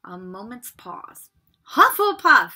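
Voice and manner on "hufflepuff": higher, louder announcing shout; the hat bellows the house name. Acted. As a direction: announce the house to the whole hall. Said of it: "shouted the hat".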